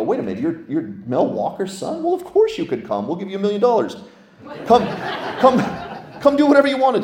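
rising into a high, gushing invitation; imitating a church rolling out the red carpet; mock eager welcome. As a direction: ham up an overeager host.

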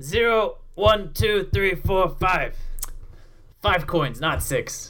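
lightly voiced slow orc counting coins; half-orc bartender. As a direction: plod through the count like a dim orc. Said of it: "counts it".

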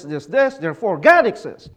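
ordinary talk

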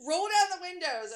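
high, urgent wail; screamed demand. A narrator's reading. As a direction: cry out to get the windows down. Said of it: "As I screamed".

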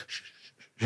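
quick hushed shushes; whispered "shh", trying not to be noticed; embarrassed shushing. Retold or imitated. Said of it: "he's like".

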